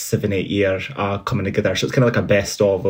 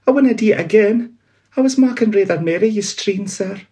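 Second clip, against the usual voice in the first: much higher, begging clerk; pleading Bob; 12 semitones higher, 4 dB louder than usual. Acted. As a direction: plead for mercy like a frightened clerk.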